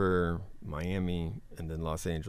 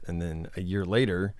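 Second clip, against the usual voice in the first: normal talking voice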